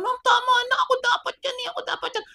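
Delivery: very high, acted begging; child pleading. Child begging to take the blows instead, high and pleading. Child begs teacher to stop.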